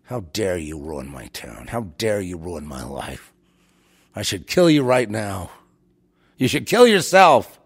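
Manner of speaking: low, slow, contemptuous mock accusation; a lightly voiced hateful townsperson; scornful townsfolk. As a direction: sneer it out as the scornful locals. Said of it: "they're looking at you with scorn".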